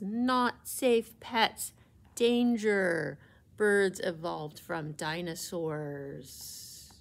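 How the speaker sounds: slow, loud warning sign voice; warning slogan. Acted. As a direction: drag it out like a danger alarm. Reading dinosaur poster aloud.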